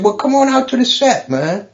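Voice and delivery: high-pitched imitation of a friend inviting; friend's eager invite. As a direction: invite him along, voice lifted.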